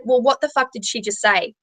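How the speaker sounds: ordinary delivery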